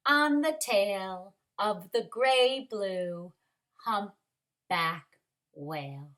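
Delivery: slow, settling picture-book ending; story's closing narration. A narrator's reading. Teacher closes the story for kindergarteners, slow narration. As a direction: space out the last words, sinking to the end.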